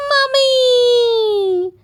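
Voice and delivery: high, loud shout. A narrator's reading. George dressed as a mummy shouting, high and loud. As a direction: yell it out proudly. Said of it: "shouted George".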